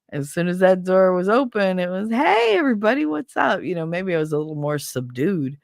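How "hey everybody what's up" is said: cheery greeting. Retold or imitated. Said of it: "I did my heartbreak nice and quiet".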